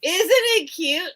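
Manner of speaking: slow, high, delighted coo; drawn-out cooing over a toy car; gushing. Real, not acted. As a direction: gush over it like a cute pet.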